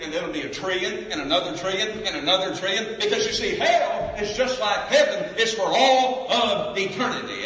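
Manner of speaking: from steady to high, loud fervour; building preaching. Acted. Preacher piling up years of hell, rising to a loud cry. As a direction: climb the numbers up into a shout.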